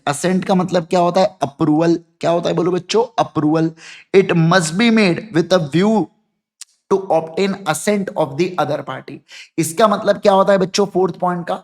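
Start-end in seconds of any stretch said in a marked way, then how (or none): 4.2-9.1 drawn out, phrase by phrase; slow dictation. Shown as a demonstration. Teacher dictating the fourth point, slow and even. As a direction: read it slowly for the notebook.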